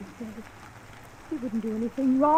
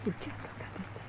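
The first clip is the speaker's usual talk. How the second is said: whispered, much softer, almost breaking; hurt whisper; 5 semitones lower, 12 dB quieter, faster than usual. Acted. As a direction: barely get the words out, wounded. Wife hurt by cruel accusations.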